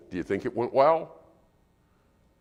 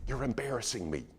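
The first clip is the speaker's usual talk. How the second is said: softer scolding aside; hissed rebuke; 9 dB quieter than usual. Retold or imitated. Mom scolding child quietly in line, softer. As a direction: scold quietly so nobody hears. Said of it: "what does she say".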